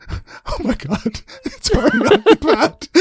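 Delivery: high, laughing snake. Retold or imitated. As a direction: giggle through it up high.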